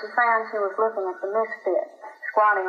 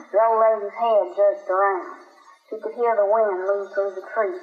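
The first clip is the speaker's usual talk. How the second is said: ordinary delivery, nothing marked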